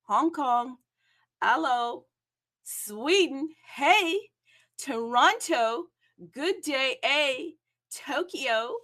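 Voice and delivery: bright shouted roll call of cities; big host voice greeting the globe; announcer-style world greeting. Acted. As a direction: hail each city like a host.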